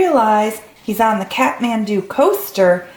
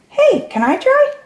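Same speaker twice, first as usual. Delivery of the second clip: eager high ask; 13 semitones higher than usual. Acted. New character eager to join.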